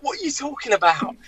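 very high incredulous question; mock bewildered squeal; baffled protest. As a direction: act out helpless disbelief. Baffled player protesting his innocence.

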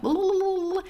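high, rushed mock chatter; nervous babbling. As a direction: mimic someone babbling nervously. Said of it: "so they're like".

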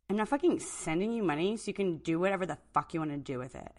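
quiet, bitter refusal; low and fed up; Sarma, fed up. Acted.

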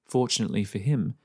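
usual speaking voice

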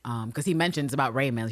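usual speaking voice

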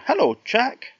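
high, light little bird voice; tiny voice calling up from the trough; wee robin voice. Acted. Robin greeting Jack at the trough, high wee voice. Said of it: "he heard a wee voice".

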